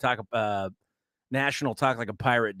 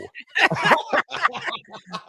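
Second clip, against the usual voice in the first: laughing; 9 semitones higher than usual. Real, not acted. Laughing at own pirate pun.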